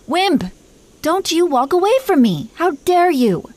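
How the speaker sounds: high, outraged call after someone leaving; indignant shout. Acted. Dragon queen shouting after her husband walking away, outraged. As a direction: snap at him to come back, offended. Husband walks off mid-speech.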